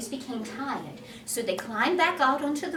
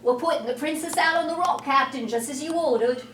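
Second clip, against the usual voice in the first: 1 Smee; 4 semitones higher, 5 dB louder, faster than usual. Acted. Smee reporting.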